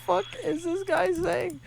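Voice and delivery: high, slow, incredulous voice; mock disbelief. Reacting to the screaming stranger, high disbelief. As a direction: gasp it out in disbelief. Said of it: "I was like".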